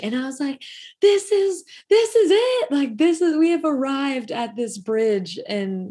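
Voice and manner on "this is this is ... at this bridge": overjoyed. Retold or imitated. Retold joy at the apology.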